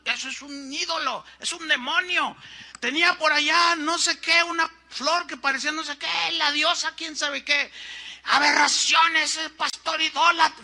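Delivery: high, loud accusing pulpit cry; angry preaching. Retold or imitated. Pastor accusing his host of idolatry from the pulpit, loud and high. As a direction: thunder the accusation like a fiery preacher. Pastor's sermon retold by leader.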